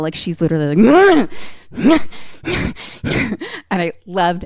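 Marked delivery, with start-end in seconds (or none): 1.3-2.4 high, rough wounded-animal cry; wounded animal noise. Retold or imitated. Grace cornered with the dagger, wounded animal noise. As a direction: imitate a cornered, hurt animal. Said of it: "like a wounded fucking animal".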